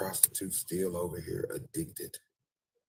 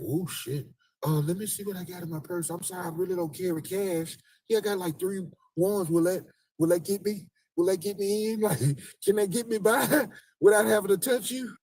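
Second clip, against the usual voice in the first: much higher, anxious rambling; 12 semitones higher, 4 dB louder than usual. Retold or imitated. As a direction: fumble for an excuse to avoid touching. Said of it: "They like".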